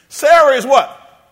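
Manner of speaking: normal talking voice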